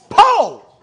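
high, loud outraged yell; calling out in protest. Acted. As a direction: yell the name as if appalled. Acting a reaction to a fart.

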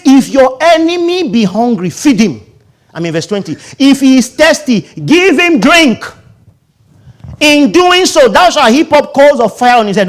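high, loud scripture proclamation; preached scripture. Acted. Preacher reading verse twenty to the church, loudly. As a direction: read it out like thunder. Scripture read at full force.

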